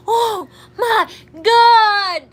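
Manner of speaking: loud, slow, laughing Oh my God; laughing shriek. Real, not acted. Bursts out laughing at mistake.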